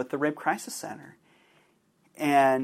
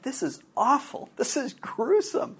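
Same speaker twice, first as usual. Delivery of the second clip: horrified; 10 semitones higher than usual. Retold or imitated. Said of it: "But there was a part of me that was like".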